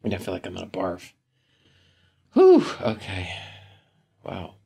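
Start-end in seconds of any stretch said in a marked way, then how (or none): 2.3-3.3 loud, real blown-out breath before okay; heavy exhale. Real, not acted. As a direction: blow the air out hard to steady yourself. Nauseous from recalling vomiting.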